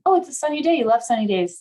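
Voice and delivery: brightly pitched, upbeat coaxing; coaxing friend. Acted. Friend nudging someone out of a funk, upbeat. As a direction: play the friend trying to cheer someone up. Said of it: "your partner or friend says".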